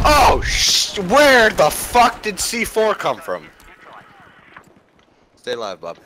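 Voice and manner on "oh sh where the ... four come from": raging gamer. Real, not acted. Killed by C4, raging.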